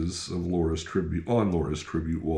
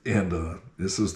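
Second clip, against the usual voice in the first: normal talking voice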